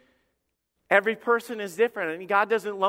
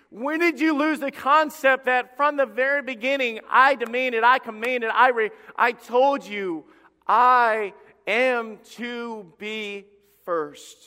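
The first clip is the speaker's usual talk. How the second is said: raised, louder voice of divine authority, slowing at the end; indignant God, demanding; 4 semitones higher, 5 dB louder, slower than usual. Acted. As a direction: preach it as God's forceful reproach, ending word by word. God voiced demanding first place.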